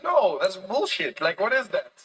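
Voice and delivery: loud retold outburst; exasperated disbelief. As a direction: call it out in loud, mock exasperation. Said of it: "And I was like".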